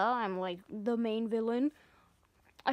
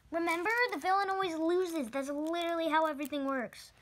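ordinary delivery

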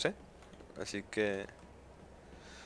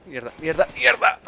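frustrated cursing; 5 semitones higher, 8 dB louder than usual. Real, not acted. Getting shot in game.

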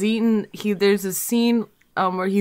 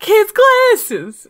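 hammed-up grief, high and loud; anguished mock wail; 12 semitones higher, 8 dB louder than usual. Imitating the girl in the sad movie scene, anguished wail. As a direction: cry it out like a tragic film girl. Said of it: "supposed to be really sad".

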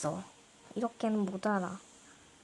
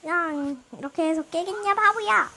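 playful scolding yell; 6 semitones higher, 7 dB louder than usual. Acted. Scolding someone in game.

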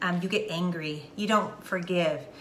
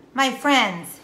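ordinary talk, nothing marked

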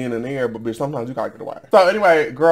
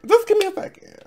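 much higher, stress on Just; high-pitched repeat; 4 dB louder than usual. Real, not acted. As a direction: say Just give me a second up high, leaning on Just.

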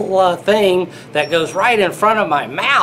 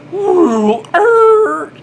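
plane whoosh; 10 semitones higher, 4 dB louder than usual. Pretend. Imitating plane landing noise.

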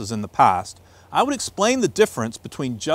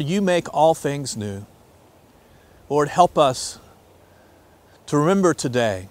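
prayer; 3 semitones lower than usual. Acted. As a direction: pray it reverently to God. Leads a prayer.

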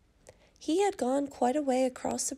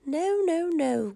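high, loud refusal that drops at the end; little pig's storybook cry of protest; 5 semitones higher, 4 dB louder, slower than usual. A narrator's reading. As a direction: cry out high, then come down. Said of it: "cried the little pig".

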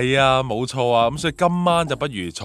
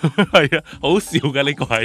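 laughing; 6 semitones higher, 5 dB louder than usual. Real, not acted. Co-host laughs at funny scene.

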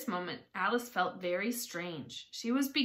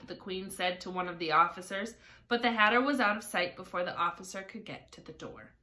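usual speaking voice

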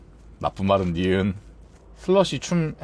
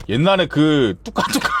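usual speaking voice

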